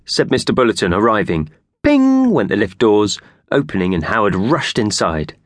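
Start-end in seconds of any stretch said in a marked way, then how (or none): none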